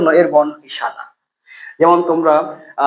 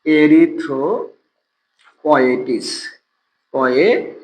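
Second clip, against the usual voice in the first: slow drawn-out term; much slower than usual. Shown as a demonstration. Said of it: "teacher drills term slowly".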